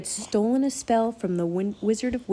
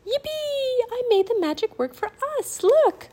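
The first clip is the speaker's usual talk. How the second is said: gleeful cheer. A narrator's reading. Annie finds her magic worked, gleeful cheer. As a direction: cheer in a high girlish pitch. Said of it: "heard Annie giggle".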